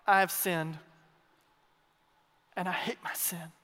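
low, subdued admission of guilt; somber confession. Retold or imitated. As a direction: drop low and confess it soberly, with pauses. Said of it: "but in my somber moments, I go".

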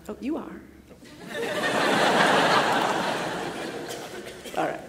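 normal talking voice

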